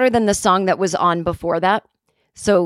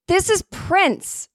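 retold yell across; 10 semitones higher, much slower than usual. Customer repeats the correction to confused young guys, mock yell. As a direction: stretch it out like calling across a room. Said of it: "I yell across".

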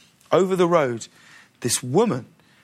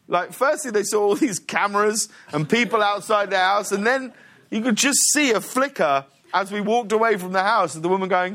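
normal talking voice

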